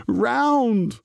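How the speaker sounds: high, loud cry of frustration; frustrated shout. A narrator's reading. Muddle yelling at the stubborn dough. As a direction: shout it out in exasperation. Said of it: "Muddle shouted in frustration".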